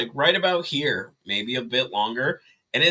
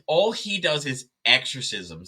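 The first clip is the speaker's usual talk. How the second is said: ordinary talk, nothing marked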